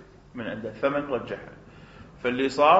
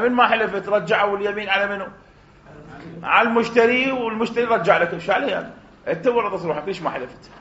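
angry blaming; 6 semitones higher, 4 dB louder than usual. Acted. Agent shouts blame at seller.

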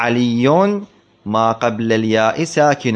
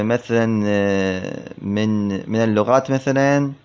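ordinary delivery

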